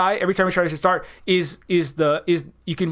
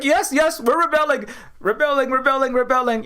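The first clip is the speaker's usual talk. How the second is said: higher-pitched, repetitive parody of eager followers; mock eager chorus; 5 semitones higher than usual. Followers insisting they are rebelling, mock eager chorus. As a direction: chant it like obedient mock rebels.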